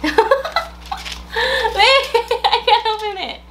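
laughing while fumbling, slow; real laugh through the words, struggling with the packet. Real, not acted. Owner fighting the treat packet, laughing slowly.

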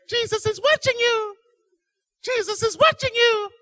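very high piercing falsetto; mocked parrot voice. Robber quotes the annoying parrot back, high and piercing. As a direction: mimic the squawky little bird. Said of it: "sit there and say".

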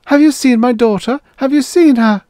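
high, loud, urgent woman's cry. A narrator's reading. Mother searches for her daughter, crying out. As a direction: call out for a missing child. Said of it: "A woman was crying somewhere".

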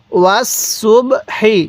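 slow, syllable-by-syllable ওয়াস সুব হি; model recitation. Shown as a demonstration. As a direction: say ওয়াস সুব হি slowly, each syllable separate. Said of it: "কীভাবে শিখব?".

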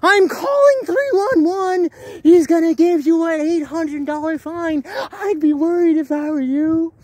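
high, loud shrill-mocked panic; mocking the woman. Mocking the woman who threatened to call 311. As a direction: mimic her frantic outburst, high and loud. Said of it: "she comes out of nowhere".

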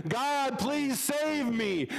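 high, slow plea for rescue; pleading rabbi. Acted. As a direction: call up to God, begging and stretching the words. Rabbi cries out in flood.

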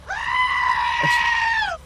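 a sudden high shriek of laughter. Real, not acted. Startled by a chat alert, a shrieking laugh. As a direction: burst out laughing, startled awake.

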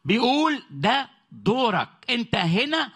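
high, impassioned sermon voice, rising to a peak; fiery preaching. Acted. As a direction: rouse the congregation with every line. Preaching at full force.

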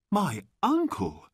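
put-on high, astonished boy; surprised. A narrator's reading. Boy learns of an uncle, surprised. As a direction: question it in amazement, voice leaping up. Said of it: "He was very surprised".

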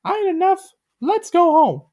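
very high, loud cartoon plea; squeaky begging; Gumby's high voice. A narrator's reading. Gumby begs Pokey to go home, high voice. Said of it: "Gumby said".

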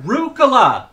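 Rucola yelled high and loud with a falling end; calling out. Pretend. Dad shouting his daughter's name across the park.

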